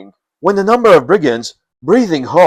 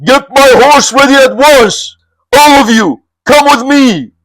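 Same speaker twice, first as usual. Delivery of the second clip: high, louder commanding call; rally the men; calling orders; 10 semitones higher, 5 dB louder than usual. Acted. Bandit chief ordering his men to ride, calling out. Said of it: "He called to his men".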